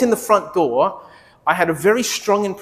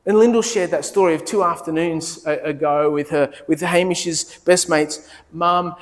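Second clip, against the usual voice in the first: ordinary talk, nothing marked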